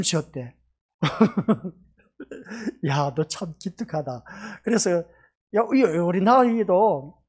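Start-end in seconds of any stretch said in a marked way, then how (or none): none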